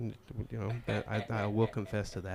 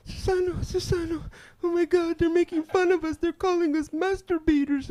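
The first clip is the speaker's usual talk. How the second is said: very high, louder upset squeal; whiny girly voice; 7 dB louder than usual. Retold or imitated. Coworker complaining to the crew, very high whine. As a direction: shriek it up high like a tattling kid.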